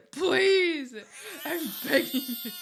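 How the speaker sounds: high, hammed-up crying beg; fake-sob through a laugh; mock sobbing plea.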